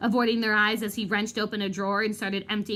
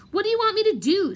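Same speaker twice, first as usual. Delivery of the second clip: weak plea; 9 semitones higher, 5 dB louder, faster than usual. Acted. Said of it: "said Lockhart weakly".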